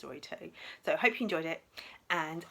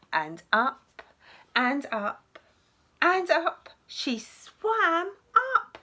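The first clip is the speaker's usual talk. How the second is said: lively, expressive picture-book reading, higher than her own chat; storytelling to children; 6 semitones higher, 10 dB louder, slower than usual. Acted. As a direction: read it to little ones with wide-eyed drama. Teacher reads picture book aloud.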